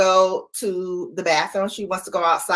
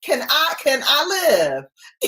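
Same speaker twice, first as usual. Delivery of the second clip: much higher, mock-offended pleading; indignant squeal; 12 semitones higher, faster than usual. Owner to her dog barging into the bathroom, high mock outrage. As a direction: squeak it out like you're cornered, affronted. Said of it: "And I was like".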